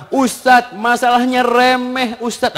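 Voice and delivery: tag. Acted. Acted women complaining to the ustadz.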